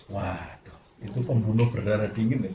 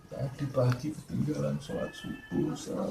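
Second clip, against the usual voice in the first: tearful confession; 3 semitones higher, 11 dB quieter than usual. Retold or imitated. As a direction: whisper it out between sobs. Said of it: "nggak nangis seperti orang itu". (mengaku pelan sambil menangis)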